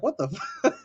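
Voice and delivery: laughing through the words; a stunned laugh breaking in; laughing disbelief. Retold or imitated. Laughing at the wrestling spot.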